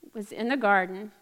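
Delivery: normal talking voice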